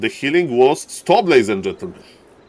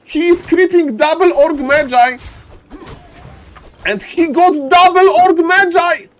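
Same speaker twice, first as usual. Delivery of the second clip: ecstatic shout; 7 dB louder than usual. Real, not acted. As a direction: scream with disbelief at the play. Caster shouts at big play.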